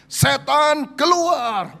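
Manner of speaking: high, loud exorcist command; commanding rebuke. Retold or imitated. Preacher retelling the pastor casting out the demon, loud high command. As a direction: bark the demon out with full authority. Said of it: "dia bilang".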